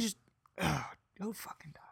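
whispered under breath; exasperated whisper. Real, not acted. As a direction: hiss it in mock exasperation. Mock exasperation at friend.

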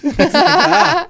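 slow high laugh; drawn-out laughing ah. Real, not acted. Laughing at own joke.

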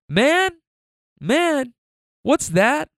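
put-on high-pitched curious toddler; Baby Phil. Toddler asking about the car screen, imitated curious kid voice. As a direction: squeak it out like a little kid. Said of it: "he keeps asking her all these questions".

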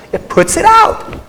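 high, slow put-on voice of the world; mock worldly voice. Speaker mocks the world's impatience in a high voice.

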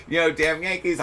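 ordinary talk

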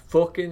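"fucking" said as "fookin"; Irish vowel on "fucking"; Irish-style fucking. Shown as a demonstration. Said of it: "it's, like, F O O K I N".

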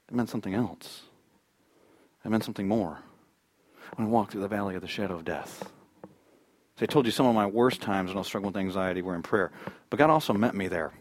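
low, soft, reflective delivery; hushed preaching. Real, not acted. Preacher confides his worst times to the congregation, hushed.

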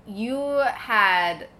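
ordinary talk

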